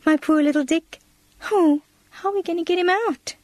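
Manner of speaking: voiced child's anxious exclamation; distressed cry. A narrator's reading. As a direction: plead for a trapped pet. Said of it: "cried Minnie".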